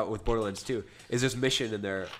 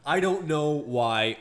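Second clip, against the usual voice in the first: drawn-out, louder mock answer; slow loud imitation; 4 semitones higher, 6 dB louder, much slower than usual. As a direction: stretch it out like a clueless mom.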